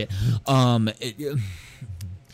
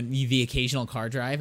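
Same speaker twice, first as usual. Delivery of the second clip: ordinary talk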